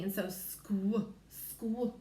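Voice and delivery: squ said alone, cut short; isolating squ. Shown as a demonstration. Teacher isolating the squ blend. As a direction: give only the squ sound. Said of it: "S sound at the beginning".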